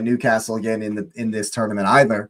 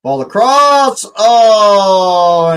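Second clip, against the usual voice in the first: excited; 8 semitones higher, 7 dB louder, much slower than usual. Real, not acted. Sudden attack in the match.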